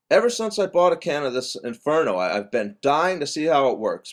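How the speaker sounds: ordinary talk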